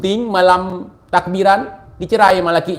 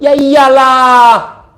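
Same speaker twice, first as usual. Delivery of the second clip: slow, louder sarcastic shout; 5 semitones higher, 5 dB louder, much slower than usual. Real, not acted. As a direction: drag it out in loud scorn. Sarcastic retort to the quote. (teriakan sinis yang diperlambat)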